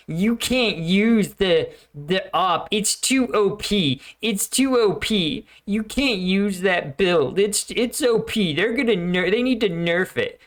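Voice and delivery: high, slow whiny complaint; whiny gamer. Retold or imitated. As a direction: whine like a salty teen gamer. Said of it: "somebody online being like".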